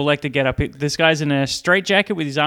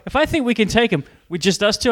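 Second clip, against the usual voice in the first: normal talking voice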